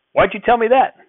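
high, loud outburst; startled shout. A narrator's reading. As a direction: burst out in alarm. Grandfather startled, angry.

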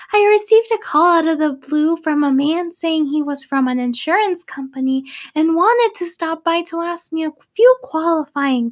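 put-on high, quavering little old lady. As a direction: read it like a sweet old lady. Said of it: "I'm gonna do an old lady voice".

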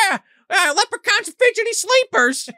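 very high, loud fairy-queen voice; hammed-up little-creature falsetto; leprechaun queen. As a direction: squeal it as a tiny queen. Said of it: "And the queen's like".

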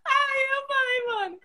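laughing till it hurts; crying laugh. Real, not acted. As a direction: giggly despair.

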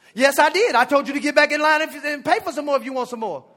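loud public retort; loud talking. Retold or imitated. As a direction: say it loud so everyone hears. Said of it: "he's loud talking me too now".

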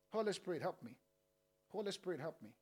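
low, soft pleading; hushed plea. Acted. Pastor leading the final prayer, softly pleading. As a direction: beg quietly for help.

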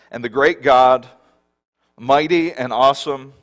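loud, ringing preacher proclamation; forceful scripture reading. Acted. Preacher reading Moses' words aloud to the church, proclaiming. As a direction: proclaim the verse to the congregation.

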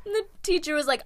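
ordinary talk

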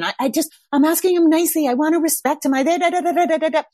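high, fast, fretful mock parent; mock anxious parent. Anxious parent explaining gentle parenting, high fast mock fretting. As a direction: rattle it off like a worried parent. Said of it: "like, the whole gentle parenting".

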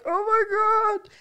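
high, slow gasp; mock awed kid. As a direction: gush like an amazed child. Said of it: "I'd be like".

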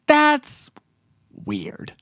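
mock unease. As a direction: act out a weirded-out viewer. Imitating a weirded-out viewer's reaction.

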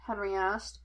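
plain reading voice

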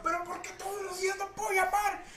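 very high whiny repeated complaint; mock complaining lady. Lady caller repeating her complaint, high whine. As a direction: squeal the same question again. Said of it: "me repitió la misma pregunta".

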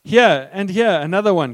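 high, loud wail; a loud wail of disgust. A narrator's reading. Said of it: "Corrie wailed".

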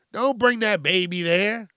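normal talking voice

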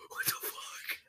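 hushed, breathless disbelief; whispered what the fuck. Retold or imitated. Boy spotting buzzards overhead, a hushed whisper. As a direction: whisper it in alarm.

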